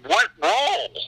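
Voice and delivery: high-pitched, puzzled comeback; mock bewilderment; baffled question. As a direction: jump up in pitch, genuinely clueless. Acting out his own confusion.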